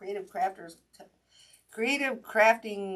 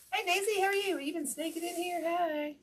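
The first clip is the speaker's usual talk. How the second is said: much higher, sing-song voice for a little animal; pet talk; 12 semitones higher than usual. Real, not acted. Host cooing at Daisy sneaking into the room, high pet talk.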